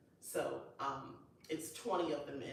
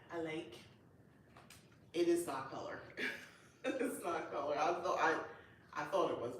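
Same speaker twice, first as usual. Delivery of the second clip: ordinary talk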